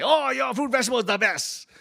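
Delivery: high, loud gushing customer; customers praising. Retold or imitated. Restaurant customers praise his produce, high and gushing. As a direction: gush over the produce. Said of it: "They say".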